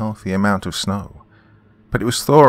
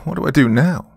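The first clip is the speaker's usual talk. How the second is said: a touch of sobbing, pitched high; mild, high-pitched despair at the sinkhole; 9 semitones higher than usual. Acted. Dawn at the sinkhole, a touch of a high sob. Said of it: "sobbed Dawn".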